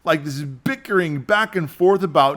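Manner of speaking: ordinary delivery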